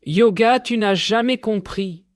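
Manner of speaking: a defiant high-pitched shout; shouting back. A narrator's reading. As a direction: hurl it at the enemy. Determined fighter lashes out.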